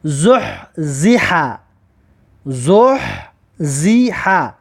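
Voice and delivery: زح زیحه drawn out very slowly; slow recitation. Shown as a demonstration. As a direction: stretch زح زیحه syllable by syllable. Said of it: "در این کلمه میاره که".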